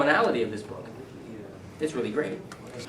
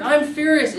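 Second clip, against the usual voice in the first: "I'm furious" said high and loud with delight at the better word; excited; 13 semitones higher, 8 dB louder than usual. Shown as a demonstration.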